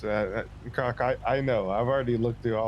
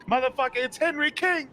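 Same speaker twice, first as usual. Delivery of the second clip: exasperated outburst; 3 dB louder than usual. Real, not acted.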